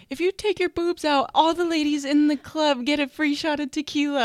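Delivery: high, loud hammed-up announcer call; mock DJ yell. DJ yelling across the strip club at her, mock shout. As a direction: belt it like a club DJ across the room. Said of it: "he yelled across the strip club at me".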